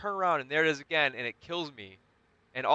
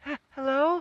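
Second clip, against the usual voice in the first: high, louder, eerie stammered hello; creepy fake voice; 8 dB louder than usual. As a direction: mimic a monster faking a human. Alien pretending to be a person.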